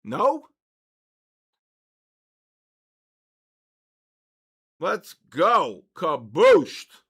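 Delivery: loud victory shout; celebrating the short paying off; triumphant cheer. Real, not acted. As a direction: punch it out like a win. Celebrating the trade live.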